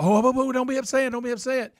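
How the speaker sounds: high mock-soothing coo; baby him in a silly high voice; hammed-up fake comfort; mocking the coddlers. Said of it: "the way we all just fell off".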